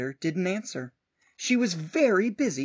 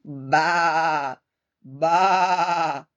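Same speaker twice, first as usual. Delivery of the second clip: loud bleating baa; sheep bleat; 7 dB louder than usual. Acted. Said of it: "bleated the sheep".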